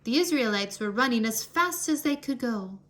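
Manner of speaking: plain reading voice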